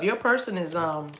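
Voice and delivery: ordinary delivery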